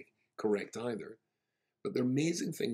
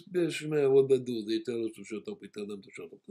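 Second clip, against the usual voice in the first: put-on Israeli accent; 7 dB louder than usual. Imitating Israeli manner.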